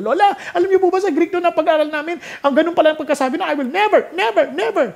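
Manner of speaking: put-on high, fast, excited child's voice; a grandchild bursting to tell Lola something. Grandchild eagerly telling Lola what Greek class taught, in a high excited child's voice.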